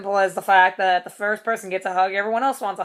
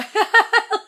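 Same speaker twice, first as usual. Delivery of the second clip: giddy laugh; 13 semitones higher, 4 dB louder than usual. Real, not acted. Excited laugh after thanks.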